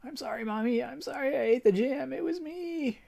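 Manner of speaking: put-on high feverish child's plea; high, pleading girl voice; delirious little girl.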